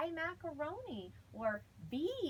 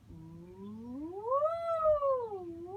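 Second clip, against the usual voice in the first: low vocal glide; 6 semitones higher, 7 dB louder, much slower than usual. Shown as a demonstration. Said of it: "I'm gonna start way down low".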